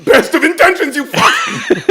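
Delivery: high, shooting up to a squeal; hammed-up exasperated yell; mock outburst. Jab at well-meaning dad, mock outraged.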